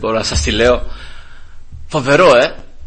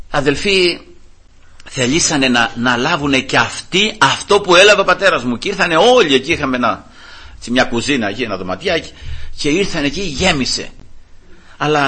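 ordinary delivery

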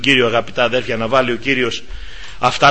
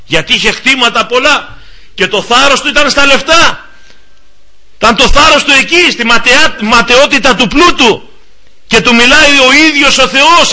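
fervent preaching; 7 semitones higher than usual. Acted. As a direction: preach it out to the flock, rising. Preaching at full force.

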